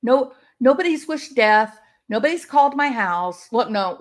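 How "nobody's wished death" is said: ordinary talk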